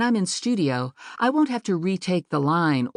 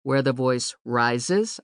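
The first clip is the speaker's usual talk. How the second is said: "rises" drawn out slowly, pitch lifting at its end; rising inflection on RISES; 2 semitones lower, slower than usual. Shown as a demonstration. Narration coach demonstrating a marked upward arrow, rising inflection on "rises". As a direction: lift the voice upward through "rises". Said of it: "a little upward arrow on the word rises".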